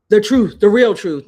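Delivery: loud, hammered emphasis; calling out the creator, loud and firm. Real, not acted. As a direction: hammer the truth home. Rant climax, shouted.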